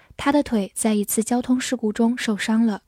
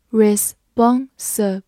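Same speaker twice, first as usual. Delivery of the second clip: slow repeat; slower than usual. Shown as a demonstration. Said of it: "R E S发ris".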